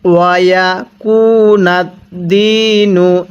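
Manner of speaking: ওয়ায়াকুনাদ্দিনু with the দি held one alif long; slow teaching recitation; drawn-out recitation. Shown as a demonstration. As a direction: stretch the দি in ওয়ায়াকুনাদ্দিনু. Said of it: "এক আলিফ টেনে পড়ব".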